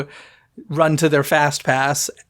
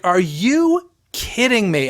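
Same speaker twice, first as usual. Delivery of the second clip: exasperated disbelief; 5 semitones higher, slower than usual. Retold or imitated. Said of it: "And I was like".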